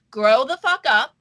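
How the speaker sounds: ordinary talk, nothing marked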